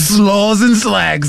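very slow, high fake British; mock British accent. Co-host echoing the tourist riff, mock British accent. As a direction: draw out each word as a Brit. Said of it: "old guy with a British accent".

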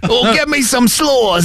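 slow, high British drawl; mock British accent. Host riffing as the British tourist, mock British accent. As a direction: stretch it out in fake British. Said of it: "old guy with a British accent".

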